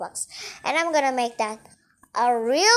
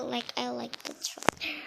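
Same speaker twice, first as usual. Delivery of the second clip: whispered aside, much softer; whispering; 2 semitones lower, 12 dB quieter than usual. Real, not acted. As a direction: lean in and whisper it. Aside while watching.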